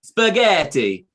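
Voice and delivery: slow, drawn-out "Spaghetti." in a hammed-up Italian accent; mock Italian. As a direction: stretch out "Spaghetti." like a cartoon Italian. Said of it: "doing this with your hands and going".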